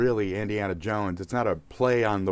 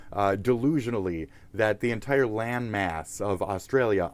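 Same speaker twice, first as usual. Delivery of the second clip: ordinary delivery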